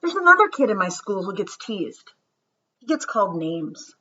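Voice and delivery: put-on gentle read-aloud storytelling for children; story reading. Teacher reading a story to her students, storybook voice. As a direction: read it to the class like a picture book.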